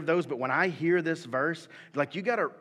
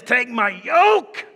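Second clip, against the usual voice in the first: much higher and louder, slow, full-force preacher shout; fiery preaching; 9 semitones higher, 12 dB louder, much slower than usual. Acted. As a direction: preach it like an old-time revival. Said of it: "I'm gonna read it like I believe it".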